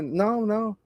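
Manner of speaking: usual speaking voice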